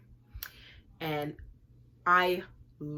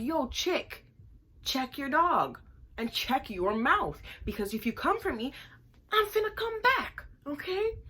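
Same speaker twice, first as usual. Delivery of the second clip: high, loud threatening rant; furious warning; 8 semitones higher, 4 dB louder than usual. Retold or imitated. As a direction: threaten her to back off. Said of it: "I'm like".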